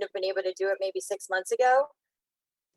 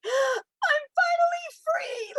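very high, slow, tearful; crying with relief; much slower than usual. Real, not acted. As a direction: let the words break in relief. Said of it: "cry and break down and be like".

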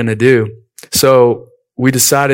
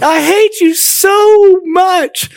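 enraged scream; 4 dB louder, much slower than usual. Retold or imitated. Younger sister storming into the living room at pranking brothers, enraged scream. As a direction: imitate a little sister's high-pitched shriek of rage. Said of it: "She was in a rage".

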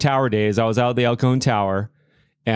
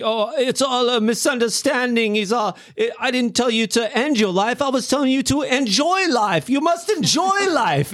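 put-on high, shaky British accent, laughing; 12 semitones higher than usual. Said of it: "shaky British accent".

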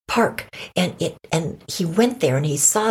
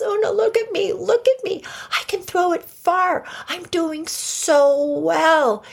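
high, excited show-off; excited squirrel; 14 semitones higher than usual. Acted. Buffy shows Una his throwing, excited squirrel voice. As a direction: call out high, stretching the last words proudly.

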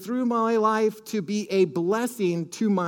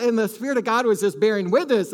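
ordinary talk

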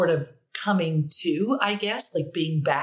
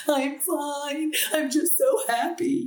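much higher, overflowing I'm fine; tearful happy teen; 11 semitones higher, slower than usual. Retold or imitated. Teen me through the bedroom door to mom, high and teary. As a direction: squeak it out happy through tears. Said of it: "And I'm like".

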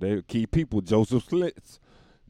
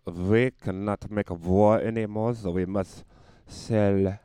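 put-on slow, deliberate German accent, building loud; measured mock German, punching the ending; 6 dB louder, slower than usual. German brewer announcing a plan, mock German accent.

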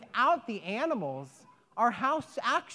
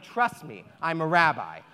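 a hint of a lower, calm rabbi voice; lightly voiced rabbi; 6 semitones lower than usual. The rabbi reassuring the woman, lightly lower voiced.

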